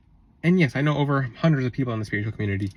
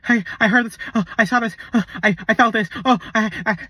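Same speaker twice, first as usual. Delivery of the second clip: anxious gossip; 5 semitones higher than usual. Retold or imitated. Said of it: "But the people like".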